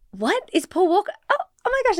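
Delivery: high, startled double take; spotting the actor, suddenly surprised. Retold or imitated. Teen fan recognizing Paul Walker, in a high surprised voice. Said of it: "I was like".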